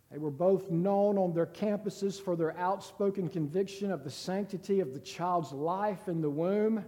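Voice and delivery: usual speaking voice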